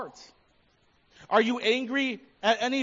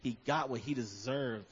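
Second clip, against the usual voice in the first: secret gloating; 9 semitones lower, 7 dB quieter than usual. Retold or imitated. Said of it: "secretly celebrated someone's failure".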